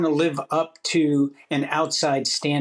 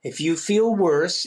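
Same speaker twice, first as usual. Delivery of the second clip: usual speaking voice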